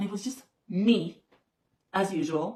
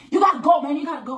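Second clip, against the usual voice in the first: higher, louder mock bouncer order; 7 semitones higher, 8 dB louder, faster than usual. Imitating the man pushing her out, a mock bossy order.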